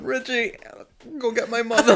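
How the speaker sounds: high-pitched retold cry for help; hurt kid calling. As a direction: play up a wounded kid calling out.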